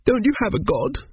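put-on high little-boy question; timid small boy. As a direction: ask in a small, high child's voice. Arthur questions Teppic.

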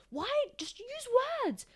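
high, softened voice of exasperation; exasperated plea. Real, not acted. Begging the co-host to use words, exasperated. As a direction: plead in disbelief, pitch shooting up.

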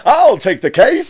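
very high, loud, slow hero line; cartoon hero voice. Host quotes the cartoon lawyer high and loud. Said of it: "Harvey Birdman quote".

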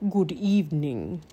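low, raspy villain greeting; raspy Raven King. A narrator's reading. Raven King greets the stunned children, raspy and low. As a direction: greet them as a sinister bird king. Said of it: "he asked in a raspy voice".

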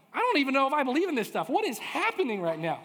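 loud, high-pitched disbelief; comic incredulous outburst; acted bewilderment. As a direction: exclaim it like you're totally thrown. Acting his bewilderment at the scene.

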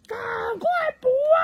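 拖长音高声呼喊; 全家一起朝天上喊. A narrator's reading. 重复全家的喊话. (一家人一起大喊)

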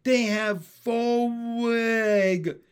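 high, loud, last word held long; drawn-out gleeful holler; excited shout. A narrator's reading. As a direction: proclaim it slowly like a thrilled frog. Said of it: "shouted Pin".

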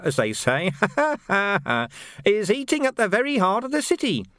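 menacing Carcer with a sly, amused edge; sneering sergeant. Acted. Carcer taunting the Major about the burned watch house, sly. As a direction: needle the officers with mock concern.

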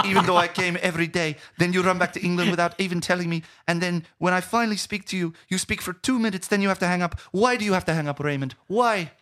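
put-on very high, loud girlfriend voice; hammed-up high-pitched tirade; upset Chloe. Girlfriend berating him for leaving without telling her, high and frantic. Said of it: "And she goes".

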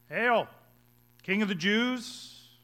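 loud, high mock salute, drawn out; sarcastic. Soldiers saluting Jesus in mockery, sarcastic. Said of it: "Sarcastically, obviously".

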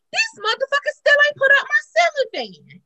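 high-pitched indignant retelling; pissed off. Retold or imitated. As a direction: complain high, reliving the outrage. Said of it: "And it pissed me off".

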